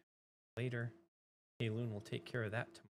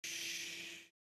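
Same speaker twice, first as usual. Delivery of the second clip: long, soft hushing sound; a drawn-out "shh"; playful shush; 6 dB quieter than usual. Pretend. Streamer jokingly hushing for a friend's kid, soft shush. Hushing after whisper demonstration.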